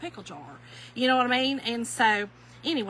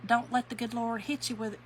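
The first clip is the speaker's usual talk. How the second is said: ordinary talk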